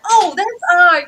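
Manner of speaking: high, loud sudden surprise; mock recognition. Fan catching a callback, surprised. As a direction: gasp of recognition. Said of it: "it's like".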